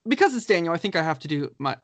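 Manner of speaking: usual speaking voice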